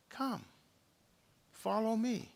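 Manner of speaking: soft but lifted, with pauses; gentle invitation. Acted. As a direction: beckon tenderly, one phrase at a time. Jesus gently calls Peter again.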